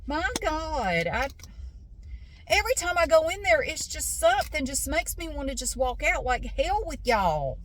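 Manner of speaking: high-pitched exasperated rant; venting, fed up with rude Costco staff; real irritation. Real, not acted. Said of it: "I'm getting irritated again".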